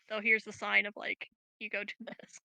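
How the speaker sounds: usual speaking voice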